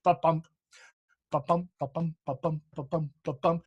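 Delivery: ba-bump chanted soft like a heartbeat, light then strong; heartbeat rhythm. Shown as a demonstration. Actor showing iambs as a heartbeat. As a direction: tap out ba-bump like a pulse, softly. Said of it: "like, a heartbeat".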